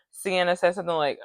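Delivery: normal talking voice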